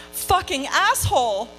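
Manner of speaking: angry. Retold or imitated. Angry diary outburst read aloud.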